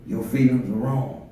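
a hint of the doubting voice inside; inner voice of doubt. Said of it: "I'm preaching that war that goes on the inside".

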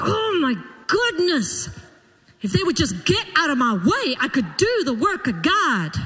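hammed-up exasperated whine; mock exasperation. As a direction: act out a fed-up complaint, stretching the first words. Acts out exasperated complaint about spouse.

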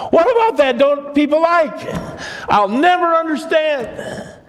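rising delight, can't believe they miss it; excited. Real, not acted. Preacher marvels at Christmas hymns.

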